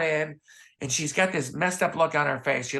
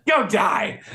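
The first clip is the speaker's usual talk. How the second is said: mock curt Rose; 8 semitones higher, 4 dB louder than usual. Rose voiced hanging up.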